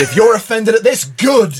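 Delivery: cheeky mock defiance; defiant jab. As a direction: toss it at them defiantly. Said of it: "and said".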